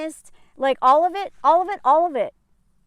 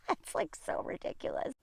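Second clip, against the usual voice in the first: hushed, incredulous whisper; a breathy whispered aside; whispered disbelief; 3 semitones lower, 14 dB quieter than usual. Real, not acted.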